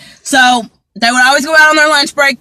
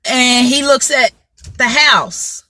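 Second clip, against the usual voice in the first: ordinary delivery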